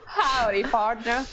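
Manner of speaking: "Howdy, partner" drawn out, American "pardner"; Southern American accent. Shown as a demonstration. Contestant retrying for the host, drawn-out Southern American accent. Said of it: "can you say howdy".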